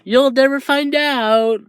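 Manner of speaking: high, drawn-out tease; sing-song taunt. Acted. Hagakure punches Tokoyami unseen, sing-song taunt. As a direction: taunt from hiding, stretched out high. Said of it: "Hagakure says".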